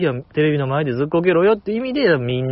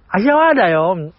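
higher, lightly imitated young actress; 芦田愛菜 impression; 4 semitones higher, 4 dB louder than usual. Showing the older version of the impression, in light mimicry. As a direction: introduce yourself in playful mimicry. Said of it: "八代優さんの".

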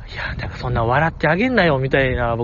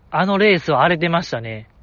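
normal talking voice

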